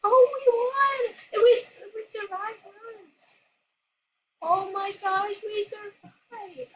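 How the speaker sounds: high, loud, thrilled cry of victory; excited cheer. Real, not acted. Player celebrating surviving the round, thrilled shout. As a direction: cheer the win, voice cracking with joy.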